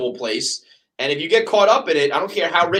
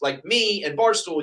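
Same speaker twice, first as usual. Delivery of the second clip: ordinary delivery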